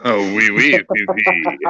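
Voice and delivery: hammed-up French accent, mocking. Host answers panel's question in a mock French accent. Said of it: "do you do it with a French accent?".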